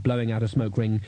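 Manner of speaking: plain reading voice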